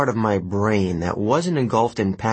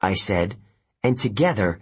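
usual speaking voice

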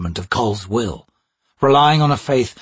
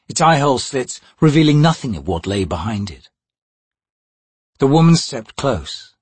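plain reading voice

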